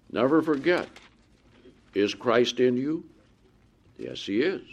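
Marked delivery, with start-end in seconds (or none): none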